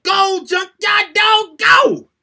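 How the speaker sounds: high, loud, slow cheering chant; cheering shout. Retold or imitated. A boy and his aunt cheer the wrestler on TV, shouting. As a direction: cheer your hero on with everything.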